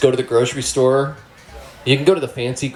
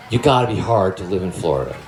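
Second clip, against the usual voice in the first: ordinary talk, nothing marked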